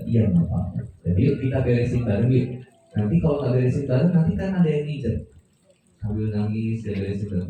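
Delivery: low, gentle reassurance; soothing parent. Pretend. Parent comforting child after breakage.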